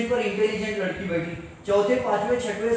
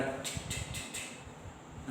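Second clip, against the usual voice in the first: soft unvoiced knock-knock noise, much softer; knocking sound; 18 dB quieter, much slower than usual. Teacher mimics a latecomer knocking at the classroom door, soft voiceless knock sounds. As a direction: tap out a quiet knock on a door with the mouth. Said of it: "दरवाजा खटखटा रही".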